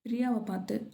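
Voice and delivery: usual speaking voice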